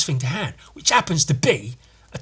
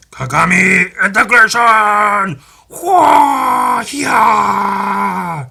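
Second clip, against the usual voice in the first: 1 battle cry; 7 semitones higher, 9 dB louder, much slower than usual. Acted. Voicing tiny aliens shouting.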